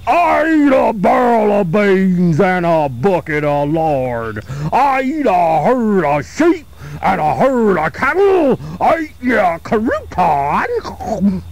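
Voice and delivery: slow, booming monster voice; mean giant's boast. Acted. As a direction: threaten like a hungry ogre. Giant fat man answers the taunt.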